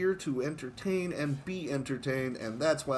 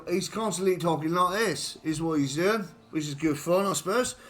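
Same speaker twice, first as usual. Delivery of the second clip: put-on Cockney voice for Johnny; Cockney accent; 3 semitones higher than usual. Shown as a demonstration. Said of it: "he's written to have a Cockney accent".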